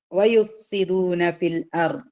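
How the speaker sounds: slow, measured tajweed chant; Quran recitation. Shown as a demonstration. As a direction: recite the verse carefully, drawn out. Recites Quran verse in class.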